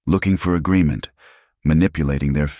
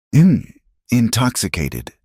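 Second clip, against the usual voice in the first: mocking her accent; 7 semitones higher, 3 dB louder, slower than usual. A narrator's reading. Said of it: "The man mocked her accent".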